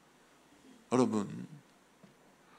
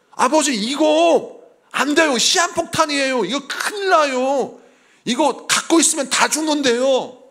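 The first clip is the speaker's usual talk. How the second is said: higher, louder, slow pleading son; alarmed son pleading; 7 semitones higher, 7 dB louder than usual. Retold or imitated. Son begs his father, alarmed and pleading. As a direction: beg your father to get rid of it.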